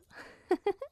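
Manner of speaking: high, bright laugh at her own joke; teasing giggle. Acted. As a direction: giggle after the tease.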